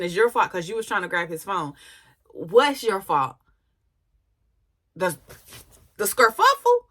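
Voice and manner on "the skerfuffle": "skerfuffle" squeaked up high and loud; mocking skerfuffle. Real, not acted. Vlogger mocking her word skerfuffle, high and loud. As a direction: pitch "skerfuffle" way up in disbelief. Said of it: "y'all had a skerfuffle".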